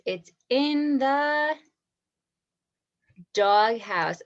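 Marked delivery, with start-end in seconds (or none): 0.1-1.5 prompting pause. Shown as a demonstration. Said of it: "Use those describing words".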